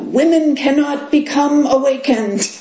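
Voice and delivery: imitating the Korean teacher's forceful Korean accent. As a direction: declare it in a strong Korean accent. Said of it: "in his strong Korean accent with his forcefulness".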